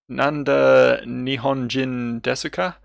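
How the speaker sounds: slow, effortful Japanese with a questioning rise; halting stab at a Japanese question; tentative Japanese attempt. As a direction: pick through the Japanese word by word. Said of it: "I'm taking shots at this".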